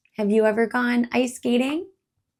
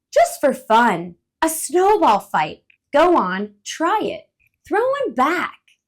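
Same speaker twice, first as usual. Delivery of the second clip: louder, higher coaxing bird; playful bird; 6 semitones higher, 8 dB louder than usual. Acted. Coaxing playful bird. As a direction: bird urges squirrel to throw back.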